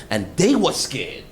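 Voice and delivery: normal talking voice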